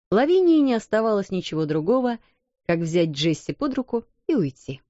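ordinary delivery

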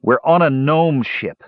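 fervent dismay. A narrator's reading. Brother realising they are on a gnome ship, dismayed. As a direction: draw out the dreadful realisation. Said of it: "Tannen prayed fervently".

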